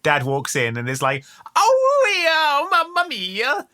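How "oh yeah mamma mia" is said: high hammed-up Italian accent, slow; mock Italian dad. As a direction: cry out like a cartoon Italian papa. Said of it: "never misses an opportunity to list Italian foods".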